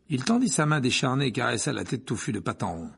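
ordinary delivery

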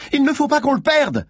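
very high, urgent shout; urgent yell. A narrator's reading. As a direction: yell it to spur on the chase. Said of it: "hurla Malines".

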